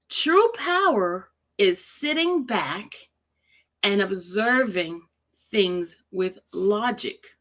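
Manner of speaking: deliberate, spaced-out quote reading; slow reading. Shown as a demonstration. Rereading a quote so viewers can feel it, slowly. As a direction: read slowly, pausing to let each phrase sink in. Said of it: "I'm gonna read it slowly".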